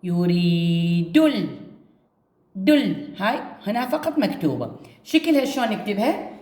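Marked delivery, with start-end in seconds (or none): 0.0-3.1 يريد stretched very slowly, then ال cut off and a short دل; broken-off ال. Shown as a demonstration. Teacher shows يريد linking into ال for pupils. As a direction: break the word at ال, linking the d sound. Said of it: "شوفوا".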